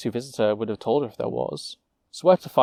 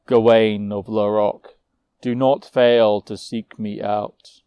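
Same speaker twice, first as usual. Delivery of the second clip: louder, slow parting warning; frost giant, grim; 4 dB louder than usual. Acted. Giant warning Gawain to seek him, slow and loud. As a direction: leave her with a heavy threat.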